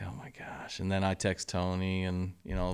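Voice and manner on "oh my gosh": near-whispered, very soft; hushed disbelief. Retold or imitated. Coach hearing bad news about his player, hushed. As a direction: breathe it out under your breath, stunned. Retold reaction to shocking news.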